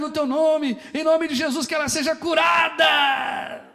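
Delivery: high, loud, slow prayer cry; shouted prayer. Retold or imitated. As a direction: cry it out over the sick woman. Oração retomada aos gritos. (oração gritada, alta e lenta)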